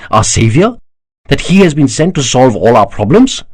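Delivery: high-pitched, rising urgency in the argument; heated insistence. A narrator's reading. Daksha insists to Kanakla that Shiva is their savior, heated. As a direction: argue your case with mounting fervour.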